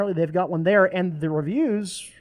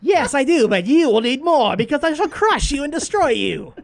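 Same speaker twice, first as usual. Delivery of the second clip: high mock villain voice; villainous threat; 7 semitones higher, 4 dB louder than usual. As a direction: threaten them like a cartoon villain. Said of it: "villain answers telemarketer".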